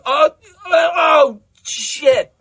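high, loud, helpless outburst; climactic cry. Acted. Desmond coming into the tube, crying out. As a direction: groan out loudly at high pitch.

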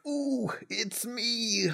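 high, drawn-out mock cooing; hammed-up slow, high "ooh"; delighted self-recognition. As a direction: pretend starstruck squeal, stretched out. Acts out Osama seeing himself onscreen.

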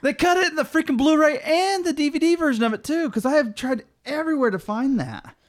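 loud, high-pitched exasperated complaint; indignant outburst. Real, not acted. Film fan complaining about the cut line, loudly indignant.

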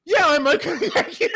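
high, loud squeaky put-on reply; laughing mock refusal. Retelling Grayson turning Isaac down, laughing mock refusal. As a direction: crack up while imitating an awkward brush-off.

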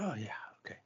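unvoiced whisper to himself. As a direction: whisper the reply under breath. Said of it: "This is me talking to myself".

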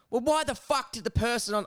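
worked-up mock protest, not shouted; nearly angry. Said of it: "I nearly got angry".

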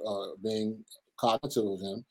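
ordinary talk

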